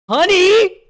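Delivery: very high, loud campy "Honey!"; sassy diva. Acted. Streamer mimics the AI's sassy tone, camp shriek. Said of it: "That honey pissed me off".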